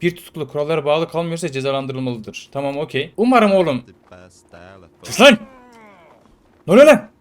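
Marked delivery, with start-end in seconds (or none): 3.2-3.8 mock tough guard. Guard voice threatens prisoner. 5.0-7.1 high, loud yell to shut them up; guard shouting. Pretend. Prison guard yelling at rowdy prisoners, loud and high.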